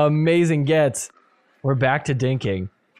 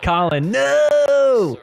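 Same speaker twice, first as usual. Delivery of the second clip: anguished no; 10 semitones higher, 3 dB louder, much slower than usual. Real, not acted. Reacting to shot in net.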